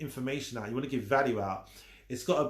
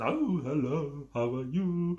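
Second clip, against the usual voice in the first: mock greeting; slower than usual. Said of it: "you don't throw in emoji signs".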